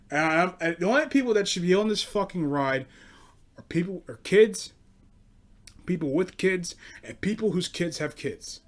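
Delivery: ordinary delivery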